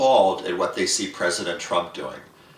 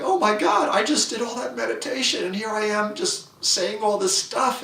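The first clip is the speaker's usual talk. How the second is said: high-pitched, horrified at yourself; dismayed inner voice; 13 semitones higher than usual. Retold or imitated. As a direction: catch yourself in the act, high and aghast. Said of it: "some part of you is going".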